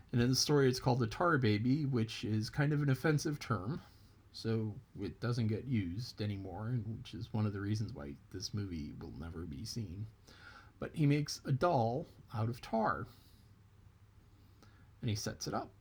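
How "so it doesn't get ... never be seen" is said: usual speaking voice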